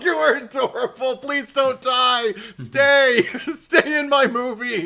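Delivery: high, loud, drawn-out playful begging; mock gushing plea. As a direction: plead adoringly at full volume, laughing through it. Gushing plea to a film character.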